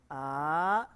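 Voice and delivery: "A" sliding steadily upward and loud; rising tone. Shown as a demonstration. Teacher modelling the second tone for students. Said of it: "Yuk ulangin sama-sama, perhatikan".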